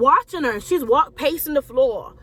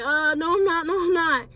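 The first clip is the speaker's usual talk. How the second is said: higher-pitched, protesting denial; mock protest, pitched up; retold reluctant refusal; 4 semitones higher than usual. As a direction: push back like someone dodging a task. Retold refusal to God's urging.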